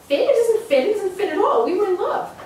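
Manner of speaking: put-on a child protesting a punishment; tearful anger. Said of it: "voice thick and clogged with tears".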